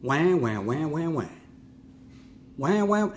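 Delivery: put-on babyish wah-wah taunt; mock crying wah wah. Preacher mocks the whiner's complaints with crybaby wails. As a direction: mimic a crybaby's whimper. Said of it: "I call it the whiner".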